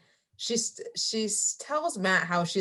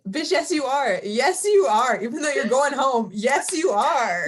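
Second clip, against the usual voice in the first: hype cheer; 6 semitones higher, 5 dB louder than usual. Retold or imitated. Hyping up the cast member.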